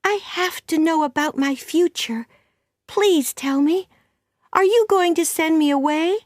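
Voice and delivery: put-on high-pitched, anxious girl pleading to know; Anne, excited. A narrator's reading. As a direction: beg urgently in an excited child's voice. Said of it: "said Anne excitedly".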